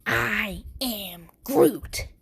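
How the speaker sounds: slow, drawn-out battle line; each word slow and defiant; slow defiant Groot declaration. Retold or imitated. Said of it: "and he's like".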